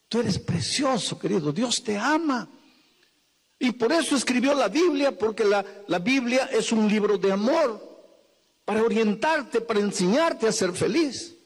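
high, urgent preaching to the congregation; full-force preaching. Acted. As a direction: preach it out, pleading and rising.

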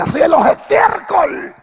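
slow, loud and high, proclaiming each word; preacher's shouted proclamation; booming scripture quote. Acted. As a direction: thunder the verse out like a prophet. Preacher quoting the psalm.

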